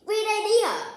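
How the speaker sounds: pitched well up, eager and pleased; excited praise. Acted. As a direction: jump on the idea with delight. Papa praises the pegboard idea.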